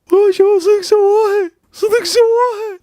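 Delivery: high, loud astonished exclaim; can't believe it actually did it; real amazement, high and loud; amazed. Real, not acted.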